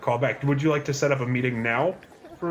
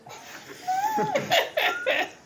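high laugh. Real, not acted. Joke about killing a superior.

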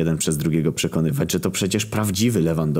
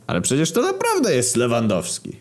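high-pitched insistent cry; exasperated exclamation; exclaimed protest; 8 semitones higher than usual. A narrator's reading. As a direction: insist in disbelief, pitch jumping up. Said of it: "krzyknął prezes Lato".

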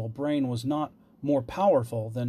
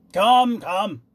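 high, loud, impatient king; 8 semitones higher, 8 dB louder than usual. A narrator's reading. King prods the silent jester, impatient. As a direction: hurry the jester along impatiently. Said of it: "the king said impatiently".

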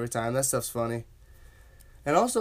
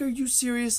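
incredulous; 10 semitones higher than usual. Retold or imitated. Retold disbelief during church giggles.

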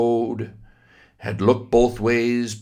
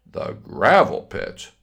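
ordinary delivery

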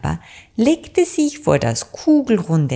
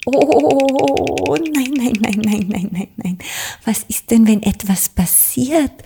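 higher, fretful little bird voice; anxious blackbird; 7 semitones higher than usual. Acted. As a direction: fret like a scared little bird. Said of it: "fragte Anton Amsel ängstlich". (ängstlich, höher, kleiner Vogel)

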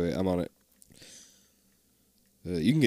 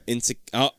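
usual speaking voice

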